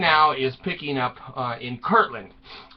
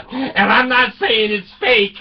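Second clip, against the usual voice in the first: ordinary delivery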